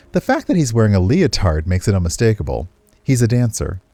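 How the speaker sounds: steady, even storytelling read; story narration. A narrator's reading. Streamer reading a game's narration aloud, measured storytelling. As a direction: narrate the scene to the viewers.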